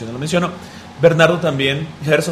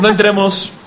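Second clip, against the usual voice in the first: laughing; 6 semitones higher, 4 dB louder, slower than usual. Real, not acted. Laughing at controversy.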